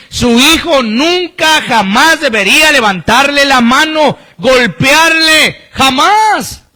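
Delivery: fervent, stretched-out exhortation; forceful preaching. Real, not acted. Preacher condemns hitting parents.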